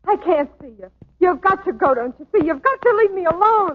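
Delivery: high, loud, near tears; distressed pleading. Acted. Dancer telling her old boyfriend he must leave, high and distraught. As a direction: push him away while breaking inside. Peggy sends Danny away, upset.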